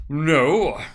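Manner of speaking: a loud, far-off blunt no; fat businessman. A narrator's reading. Fat merchant answering the gossiping businessmen, loud and curt. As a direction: bark a flat no as a pompous fat man. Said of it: "said a great fat man".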